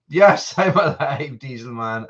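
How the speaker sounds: ordinary delivery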